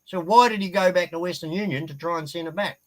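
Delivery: ordinary delivery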